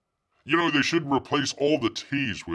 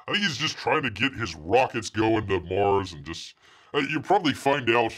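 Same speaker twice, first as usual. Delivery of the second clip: normal talking voice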